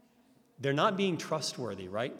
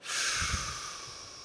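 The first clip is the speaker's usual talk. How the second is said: a long, airy, fed-up sigh; annoyed sigh; 5 dB quieter than usual. Shown as a demonstration. Speaker shows a listener how an annoyed sigh sounds. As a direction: sigh as if one more question is too much. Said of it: "Or what if I go".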